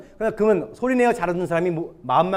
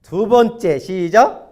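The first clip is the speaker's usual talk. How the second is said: loud, raised count-in to the congregation; calling out a cue; 4 semitones higher, 7 dB louder, much slower than usual. Real, not acted. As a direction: cue the group to start, projected. Cueing congregation to read together. (크게 구령하듯)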